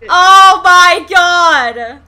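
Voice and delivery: real loud, drawn-out outcry; exasperated shout. Real, not acted. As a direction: shout it slowly in disbelief. Listener's outburst at punchline.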